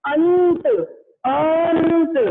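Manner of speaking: अंत called out loudly twice; loud demo. Shown as a demonstration. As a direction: belt out अंत, nasal clear before त. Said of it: "जैसे कि हम इसे बोलेंगे". (ज़ोर से अंत बोलकर दिखाना)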